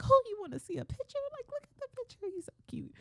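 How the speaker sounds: tiny high cooing voice; gushing new mom. Retold or imitated. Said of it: "That's how I am with everything that's dealing with him".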